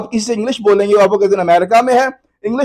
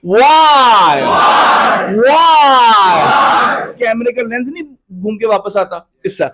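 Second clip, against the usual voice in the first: drawn-out Y; much slower than usual. Shown as a demonstration. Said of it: "अब हाथ गोल ऐसे".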